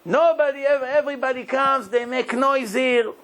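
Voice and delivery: a deeply moved king pouring out his heart, pitched high; emotional king. Retold or imitated. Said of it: "I'm so emotional now".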